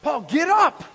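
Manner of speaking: loud, high-pitched urgent command; commanding shout. Acted. Preacher voicing Jesus ordering Paul up, commanding shout. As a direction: shout it to rouse a fallen man. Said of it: "what Jesus says".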